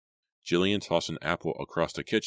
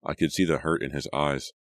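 usual speaking voice

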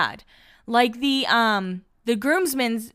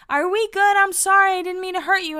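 high, louder imitation of an apology; Dina apologizing; 10 semitones higher, 4 dB louder than usual. Cast member checking in with an upset friend, high-pitched mock apology. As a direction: plead up high as a cast member making peace. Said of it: "walks over to Angelina and she's like".

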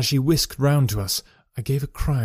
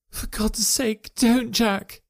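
higher, slow pleading cry; wife's plea; 10 semitones higher, much slower than usual. A narrator's reading. Wife begging her husband at the door, higher and pleading. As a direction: beg him to stop. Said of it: "she cried".

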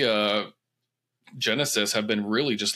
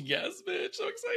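very high excited squeal; 7 dB quieter than usual. Real, not acted. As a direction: squeal it with delight.

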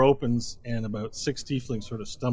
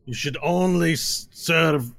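menacing lead tiefling; 3 semitones higher, 4 dB louder, slower than usual. Acted. Lead tiefling threatening the lizard bartender. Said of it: "he goes".

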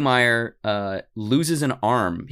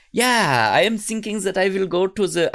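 put-on higher-pitched comic German accent; mock German voice, pitched up; 8 semitones higher than usual. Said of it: "I don't know why he has a German accent".

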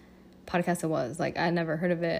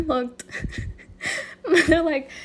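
laughing through the words, pitch jumping up; cracking up; 9 semitones higher, 4 dB louder, slower than usual. Real, not acted. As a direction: break into real giggles mid-sentence. Cracks up recalling a comment.

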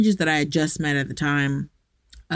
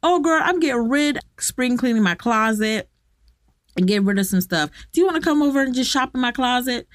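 put-on high, bubbly girlfriend voice, much higher; gushing girlfriend; 7 semitones higher, faster than usual. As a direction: gush like a friend offering clothes. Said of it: "friends would literally".

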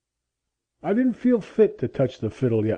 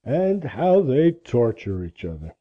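put-on deep, slow, bitter old man; envious mutter; 9 semitones lower, slower than usual. A narrator's reading. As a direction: muse darkly and slowly to himself. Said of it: "he muttered enviously".

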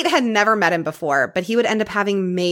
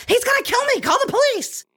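much higher, frantic imitation of a scared caller; mock panic. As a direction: pretend you're terrified and begging for help. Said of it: "wouldn't you just be like".

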